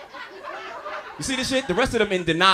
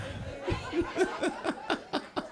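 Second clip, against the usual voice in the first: breathy laugh; 10 semitones higher, 9 dB quieter than usual. Real, not acted. Laughing at own joke.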